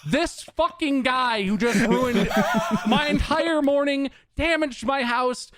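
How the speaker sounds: high-pitched, incredulous venting; outraged rant. Real, not acted. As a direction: let indignation hold your voice up high. Outraged rant about the deliveryman.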